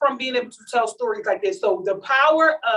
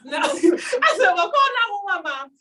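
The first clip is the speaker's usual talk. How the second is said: higher, laughing through it; 7 semitones higher than usual. Real, not acted. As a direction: crack up while saying it.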